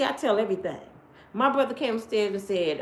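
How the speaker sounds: ordinary delivery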